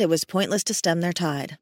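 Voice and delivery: ordinary delivery, nothing marked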